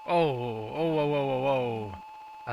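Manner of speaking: loud panicked oh oh oh; flustered, rapid startled exclamations; alarmed cries. Real, not acted. Race starts, losing control.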